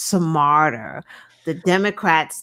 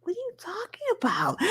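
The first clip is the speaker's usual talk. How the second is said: much higher, fast disbelief; baffled retort in a higher voice; incredulous; 14 semitones higher, 5 dB quieter, faster than usual. Retold or imitated. Speaker's incredulous retort retold.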